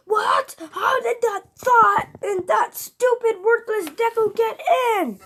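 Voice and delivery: high, loud, shrieking rage with a bitter laugh; furious high-pitched rant ending in a laugh; angry outburst. Acted. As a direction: scream it like Bakugo losing it. Bakugo raging in the story.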